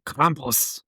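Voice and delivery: Krampus with a bad Dutch accent. Shown as a demonstration. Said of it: "say it with a really bad Dutch accent".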